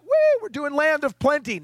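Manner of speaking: high-pitched cheer of the exiles; mock celebration. Exiles boasting of the land of plenty, mock celebration. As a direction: whoop it up, imitating carefree people. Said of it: "These guys would like".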